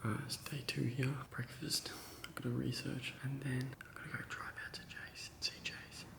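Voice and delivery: soft unvoiced whisper. Real, not acted. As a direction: whisper so sleepers don't wake. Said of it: "I'm whispering 'cause people are sleeping".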